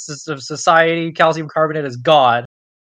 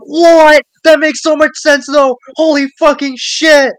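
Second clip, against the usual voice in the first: shouted much higher and louder; amazed yell; 13 semitones higher, 10 dB louder than usual. Retold or imitated. Viewer losing it over the science, a loud high shout. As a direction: scream it in sudden joyful realization. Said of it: "And I'm like".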